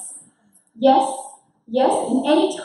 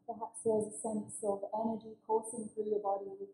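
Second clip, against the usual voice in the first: guided meditation; 8 dB quieter than usual. Acted. Facilitator guiding the group with closed eyes, soft meditation voice. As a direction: guide them into calm, softly.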